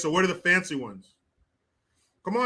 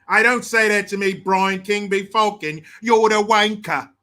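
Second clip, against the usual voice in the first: mock British accent; 6 dB louder than usual. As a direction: lay on a loud British accent. Putting on British accent for slang.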